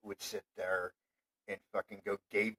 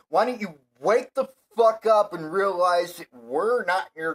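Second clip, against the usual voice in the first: shouting, much higher and louder, raging at him; furious rant; 7 semitones higher, 7 dB louder than usual. Real, not acted. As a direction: scream your fury at the bigot. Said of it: "my fucking rant on that shit".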